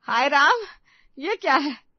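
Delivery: loud shocked cry; sudden loud shriek of shock; shouting. Acted. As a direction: yell out in alarm. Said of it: "सरिता अपने कमरे में पहुंचकर चिल्लाती है".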